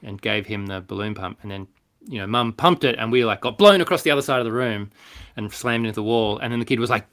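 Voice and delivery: ordinary talk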